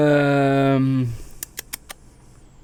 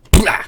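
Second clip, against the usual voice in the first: sudden loud vocal burst mimicking an implosion; imploding noise; 6 dB louder than usual. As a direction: spit out a sudden crushing sound effect. Imitates the submarine imploding.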